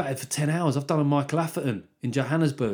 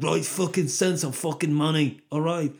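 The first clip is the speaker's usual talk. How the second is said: louder, higher, sweary charity appeal; loud, pitched-up impassioned plea; 5 semitones higher, 4 dB louder than usual. Acted. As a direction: demand the money in a forceful celebrity impression. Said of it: "Get [name] on board".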